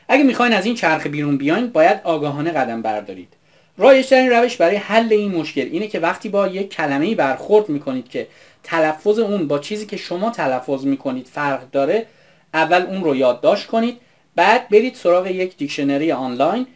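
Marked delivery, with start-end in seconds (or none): none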